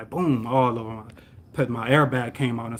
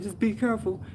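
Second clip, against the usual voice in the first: higher-pitched voice of a bystander; imitating concerned lady; 9 semitones higher, 4 dB quieter than usual. Lady to shaken driver, high-pitched concern. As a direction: gently caution him in a raised pitch. Retold lady soothing injured man.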